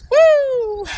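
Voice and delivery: high, loud relieved woo. Real, not acted. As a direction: blow off the heat with a woo. Overheated, exhaling relief.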